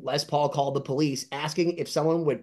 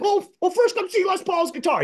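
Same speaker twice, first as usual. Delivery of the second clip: eager quote, pitched much higher; imitating Jimmy. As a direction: show off the stuff in his voice, up high. Quoting Jimmy again.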